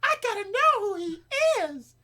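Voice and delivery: mock fangirl squeal, very high and loud; swooning girls. Host imitating girls drooling over a co-host's accent, mock swooning squeal. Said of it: "They're like".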